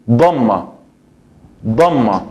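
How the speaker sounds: dhamma said the Arabic way, dom-ma; Arabic word dhamma. Shown as a demonstration. Teacher naming the Arabic vowel, careful dhamma. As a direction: say dhamma with a full double m. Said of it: "el último o la última es".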